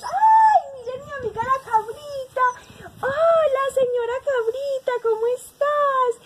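high, loud, delighted call; excited greeting. Pretend. Mother Nature spotting her goat friend, excited greeting.

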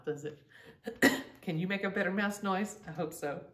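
usual speaking voice

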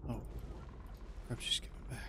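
whisper. Real, not acted. Ghost returning in horror game.

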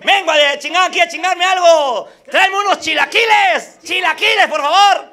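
high, boisterous shout to the waiter; calling out an order. Acted. New customer orders chilaquiles, high boisterous calling. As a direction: holler for food, high and brash. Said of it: "entró un cabrón".

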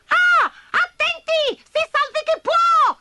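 tiny ants crying out high and loud in panic; ants shouting together. A narrator's reading. Little ants seeing the boy, panicked high shout. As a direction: squeal it like a crowd of frightened ants. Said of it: "gridarono tutte insieme".

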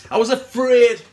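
loud, forceful admission of fear; insistent outburst. Acted. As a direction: burst out with it, hitting AFRAID hard.

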